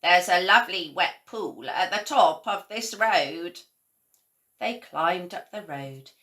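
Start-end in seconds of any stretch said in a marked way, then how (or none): 0.0-3.6 louder, lightly croaky toad; a hint of an old toad's croak. A narrator's reading. Toad continues.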